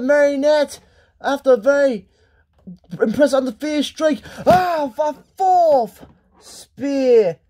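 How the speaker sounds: astonished wrestling commentary; stunned match commentator; excited play-by-play. Acted. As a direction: draw out the big move, then erupt at the kick-out. Live play-by-play, shock kick-out.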